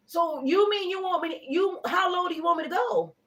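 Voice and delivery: half-hammed outrage at a haggler; appalled. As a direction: act scandalized at a lowball. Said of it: "Ugh, I'm appalled".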